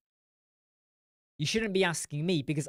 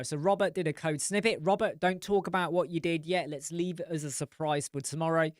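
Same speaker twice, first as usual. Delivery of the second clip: ordinary talk, nothing marked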